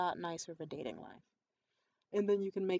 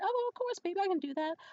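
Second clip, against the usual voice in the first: high-pitched imitation of an obliging boyfriend; exaggeratedly willing boyfriend; mock eager agreement; 10 semitones higher, faster than usual. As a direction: put on a sweet, higher voice to agree.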